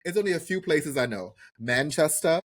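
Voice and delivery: normal talking voice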